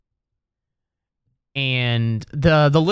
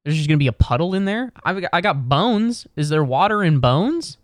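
normal talking voice

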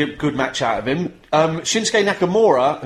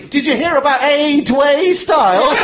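loud, high, slow tipsy impression; tipsy girlfriend; 9 semitones higher, 5 dB louder, slower than usual. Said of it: "my girlfriend was a little bit tipsy".

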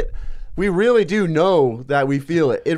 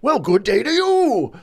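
higher, lightly put-on gentle guru voice; a genial mock greeting from a sage; a hint of Bashar's courtly manner; imitating Bashar; 7 semitones higher than usual. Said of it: "he was like".

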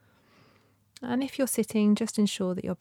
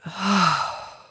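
long breathy ah release; audible ah sigh; 6 dB louder than usual. Shown as a demonstration. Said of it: "just sighing it out".

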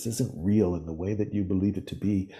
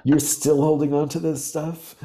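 mock scold; 9 semitones higher, 7 dB louder than usual. Tough-love parent scolding, continued.

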